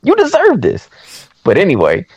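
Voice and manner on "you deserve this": mock-angry telling-off in a raised pitch; retold indignation. Viewer telling off the cheating husband in the film, indignant. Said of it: "I was getting mad".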